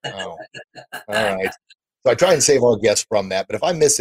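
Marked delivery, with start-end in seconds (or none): 0.0-1.5 slow, grudging mock reluctance; reluctant. Guest put on the spot, answering with slow, grudging reluctance. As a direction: drag it out as if cornered. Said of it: "I'm like".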